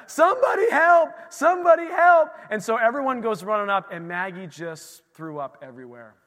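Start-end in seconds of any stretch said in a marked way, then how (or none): 0.1-2.3 high, loud panicked shout; frantic call for help. Retold or imitated. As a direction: imitate a mom yelling upstairs. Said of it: "Liz was doing the".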